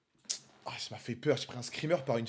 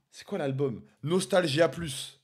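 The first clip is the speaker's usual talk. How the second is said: normal talking voice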